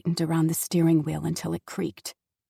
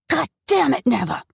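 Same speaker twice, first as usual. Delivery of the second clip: loud, furious outburst; explosive anger; 4 semitones higher, 6 dB louder than usual. Acted. Father exploding at his daughter, furious shout. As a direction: let it explode at her. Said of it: "he exploded".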